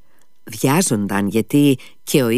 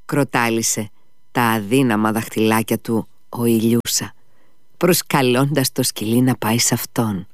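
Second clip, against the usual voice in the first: ordinary delivery, nothing marked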